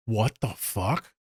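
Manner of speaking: slow, spaced-out disbelief; baffled reaction. Retold or imitated. Reacting to a client's odd text, baffled. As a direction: draw out each word, baffled.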